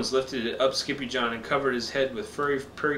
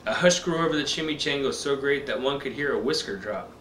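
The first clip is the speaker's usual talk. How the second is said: ordinary delivery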